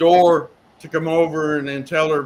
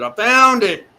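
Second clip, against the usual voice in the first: higher and louder woman's voice calling out; a woman's voice, pitched up and loud, calling "I found it"; female voice; 8 semitones higher, 7 dB louder, slower than usual. Retold or imitated. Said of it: "I hear a female voice said".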